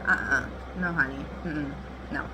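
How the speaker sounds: ordinary delivery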